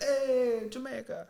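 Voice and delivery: high, lilting mock-Jamaican show voice; put-on Jamaican accent. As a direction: switch on the hammed-up Jamaican accent. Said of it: "then for her show, put her little thing on".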